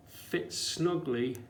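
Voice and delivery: ordinary talk, nothing marked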